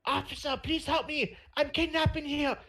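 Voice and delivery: very high, frantic put-on cry for help; high-pitched mock yelling. Retold hostage yelling for the officer, high mock yell. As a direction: squeal for help in a mock accent. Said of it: "I'm yelling at my mic".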